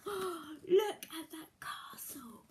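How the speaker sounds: slow, gasping awed whisper. Acted. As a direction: gasp it in wonder. Amazed at the castle.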